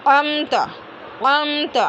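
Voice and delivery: अमत opened with a squeezed mid-throat ain; ain demonstrated. Shown as a demonstration. Teacher isolating the ain in the example, throat pronunciation. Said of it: "लेकिन बाद में आइन है".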